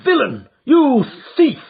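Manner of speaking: high, loud outraged yelling; furious accusation. Acted. Father catching his son with the coronet, furious. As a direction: hurl the accusation at him.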